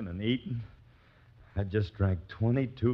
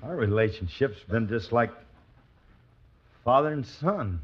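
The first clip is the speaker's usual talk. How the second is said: ordinary delivery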